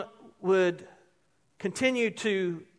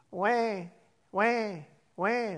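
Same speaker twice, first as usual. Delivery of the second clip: mock crying wah; 6 dB quieter, much slower than usual. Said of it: "That was me".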